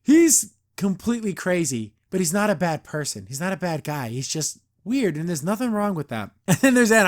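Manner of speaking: ordinary delivery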